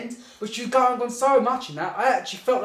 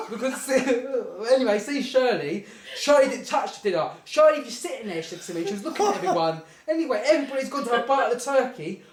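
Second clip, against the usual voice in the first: usual speaking voice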